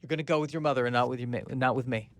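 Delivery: hurt, rattling it off under the breath; hurt fast mutter. Acted. Meg Ryan-esque character accuses David, hurt soft rush. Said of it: "Meg Ryan-esque character".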